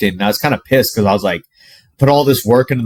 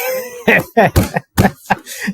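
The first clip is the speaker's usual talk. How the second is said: high-pitched burst of laughter; 11 semitones higher than usual. Real, not acted. Laughing at grandmother's jab.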